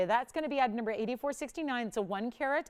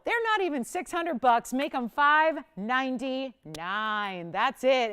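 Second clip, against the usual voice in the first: excited announcement; 3 semitones higher, 7 dB louder, slower than usual. Real, not acted. Announcing lower price to viewers.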